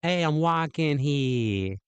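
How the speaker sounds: slow, stretched mock Brooklyn accent. User trying a classic line, Brooklyn accent. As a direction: draw it out like a Brooklynite. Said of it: "Classic Brooklyn vibe".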